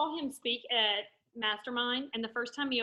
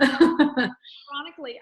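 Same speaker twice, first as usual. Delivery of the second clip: laughing; 4 semitones higher, 12 dB louder, slower than usual. Real, not acted. Laughing aside about reading.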